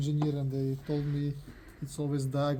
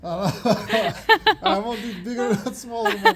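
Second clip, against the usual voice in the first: laughing; 7 semitones higher, 9 dB louder, slower than usual. Real, not acted. Laughing protest to his wife.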